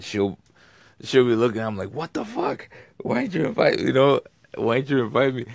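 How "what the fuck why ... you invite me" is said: high-pitched, put-out mock complaint; mock indignation. Friend griping over restaurant pictures, in mock indignation. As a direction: demand an answer in a higher, louder mock protest.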